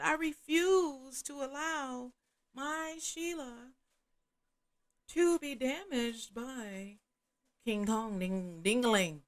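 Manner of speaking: high, slow, drawn-out words; mock-solemn declaration. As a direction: stretch each word into a grand refusal.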